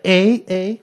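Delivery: high mocking eh, eh, eh; mocking Canadian eh. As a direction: tease them with a sing-song eh. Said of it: "it's like".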